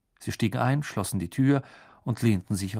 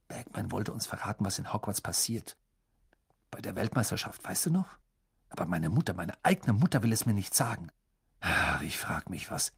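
grumbling Ron; 2 semitones lower, 5 dB quieter, faster than usual. A narrator's reading. Ron to his friends about his secretive mother, soft grumbling. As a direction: complain softly to your friends.

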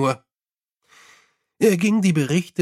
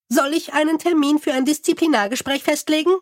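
high, eager little imp voice; hopeful imp; 11 semitones higher, faster than usual. Acted. Imp offers to book a hearing, high and hopeful. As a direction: ask it high and eager, hoping for a yes. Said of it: "fragte der Kobold hoffnungsvoll".

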